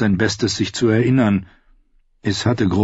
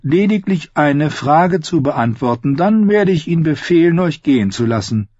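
higher, measured outlaw voice; singer outlaw, coaxing; 8 semitones higher, 3 dB louder than usual. Acted. Outlaw singer offers the captive release for one answer, coaxing. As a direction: speak as the singer offering a bargain.

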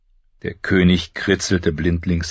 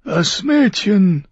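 high, strained voice of a dying king; a wounded king giving his last orders, higher and louder; 4 dB louder, much slower than usual. Acted. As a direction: push each word out through pain. Robert speaks.

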